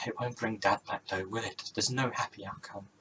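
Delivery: ordinary delivery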